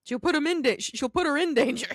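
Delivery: loud, high-pitched indignation; indignant outburst. Real, not acted. Co-host protesting the groomer endangering the dog, indignant. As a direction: burst out in exasperated protest.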